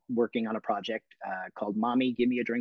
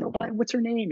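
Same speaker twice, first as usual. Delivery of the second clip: bright curious question; 12 semitones higher than usual. Retold or imitated. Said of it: "And I said".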